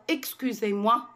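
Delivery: usual speaking voice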